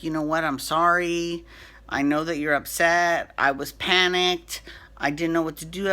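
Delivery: usual speaking voice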